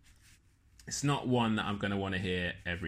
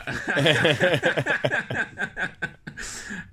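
real laugh; 8 semitones higher, 8 dB louder than usual. Real, not acted. Laughing at own joke.

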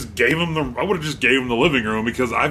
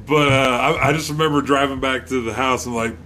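normal talking voice

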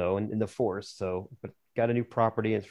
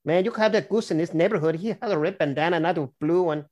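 higher, put-on Chicano street accent; 6 semitones higher than usual.

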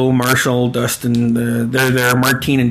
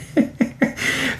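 high, airless laugh; a voiceless burst of laughter; silent laugh; 12 semitones higher, 6 dB quieter than usual. Real, not acted. Host cracking up over Ira's joke, silent laugh.

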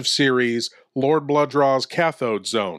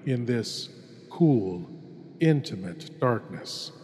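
hushed horror host; 4 dB quieter than usual. Acted. Horror host welcoming the lone listener into darkness, hushed and ominous. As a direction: draw the listener into the dark like a sinister host.

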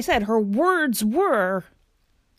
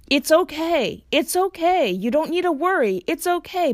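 put-on higher-pitched, stressed mother soothing her child; mock-soothing, strained and raised in pitch; imitating strained reassurance; 7 semitones higher than usual. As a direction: reassure through mounting stress, lightly acted. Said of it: "tone of her voice seemed so strained and exhausted and just stressed".

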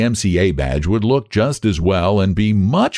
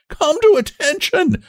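very high, would-be thundering command; changing treble voice. A narrator's reading. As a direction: try to thunder in a boy's treble. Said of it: "with a changing treble voice".

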